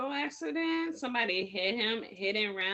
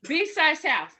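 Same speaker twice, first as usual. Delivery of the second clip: loud correction; 7 semitones higher, 7 dB louder than usual. Real, not acted.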